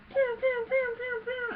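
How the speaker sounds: high, loud "pew" sounds in quick series; imitated blaster fire. Retelling Luke firing at a speeder bike, imitated blaster shots. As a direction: mimic a ray gun firing with your mouth. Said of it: "and he goes".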